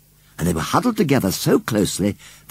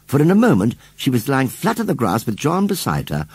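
usual speaking voice

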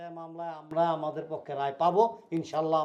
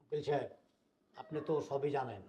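soft, respectful opening; subdued explanation; 4 semitones lower, 9 dB quieter than usual. Acted. Elder appealing to the family lawyer, subdued. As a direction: begin softly, deferring to the listener.

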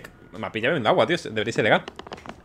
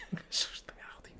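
laughing whisper; 19 dB quieter than usual. Real, not acted. Rewhispers the line, laughing.

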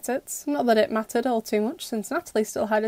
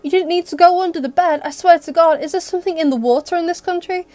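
louder, higher annoyed ranting; exasperated rant; 6 semitones higher, 7 dB louder than usual. A narrator's reading. Adrian ranting at Felix about hiding under the bed, loud. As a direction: keep yelling in disbelief.

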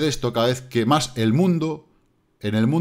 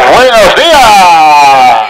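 slow, loud "Buenos días" stretched out through a megaphone; drawn-out megaphone greeting; 6 semitones higher, 18 dB louder, much slower than usual. Real, not acted. YouTuber greets the deaf viewers, slowly stretched through a megaphone. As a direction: hold every syllable of "Buenos días" like a town crier. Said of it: "tengo que sacar un megáfono".

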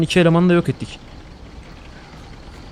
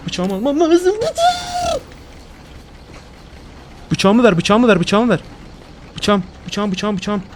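panicked crying shout; 6 semitones higher, slower than usual. Real, not acted. Grabbing for his knife under attack, a panicked crying shout. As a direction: beg desperately, voice cracking in panic.

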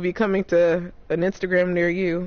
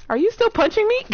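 incredulous; 13 semitones higher than usual. Real, not acted. Enemy still punching.

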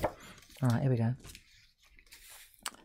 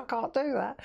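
lightly mimicked protest, pitched higher; mock a giving-up pupil in a higher voice; imitated viewers; 6 semitones higher than usual. Host quotes viewers who gave up, lightly mimicking. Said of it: "You said".

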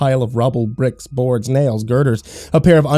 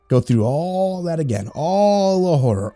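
slower, each all leaned on; gloating list; 6 semitones higher, slower than usual. Acted. Dealmaker listing the husband's torments, gloating. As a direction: savour the horror item by item. Dealer relishes her suffering.

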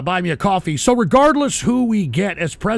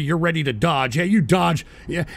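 normal talking voice

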